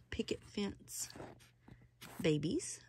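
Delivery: breathy whispered aside to viewers. Real, not acted. Telling viewers where the doll came from, in a whisper. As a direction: drop to a secret whisper for the shop name.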